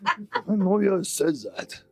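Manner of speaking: goofy high orc flattery; supportive orc. Acted. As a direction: praise your buddy like a dim sweet orc. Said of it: "The other one goes".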